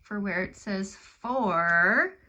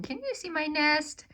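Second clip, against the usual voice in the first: higher questioning bird voice, a touch of sadness; voicing the sad bird; 8 semitones higher than usual. Sad old bird asks the bear about his nest, higher voice. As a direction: ask it up high, lightly sad. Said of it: "he's asking".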